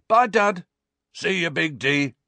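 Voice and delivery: high parting shouts from gang lads; called farewells. A narrator's reading. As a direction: holler goodbye to your mate.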